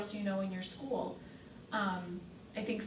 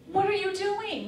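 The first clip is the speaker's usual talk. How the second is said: high-pitched, louder mock freak-out; 11 semitones higher, 5 dB louder than usual. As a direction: act out disciples freaking out at what they see. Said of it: "they're freaking out".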